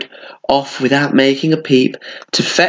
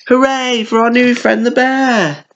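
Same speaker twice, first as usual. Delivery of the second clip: high cheer; cheering animals; 12 semitones higher than usual. A narrator's reading. Animals cheering their new friend: high cheer. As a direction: cheer for the bear. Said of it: "they all cheered".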